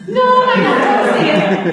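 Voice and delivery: high, loud no, correcting at once; emphatic correction. Real, not acted. Teacher corrects a student's claim: high loud emphatic no. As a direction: jump in to set the student straight.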